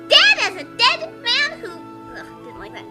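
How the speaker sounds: ordinary delivery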